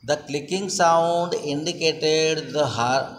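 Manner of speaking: plain reading voice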